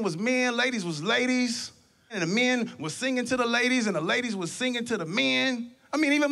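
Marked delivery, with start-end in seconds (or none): none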